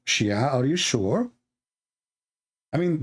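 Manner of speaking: ordinary delivery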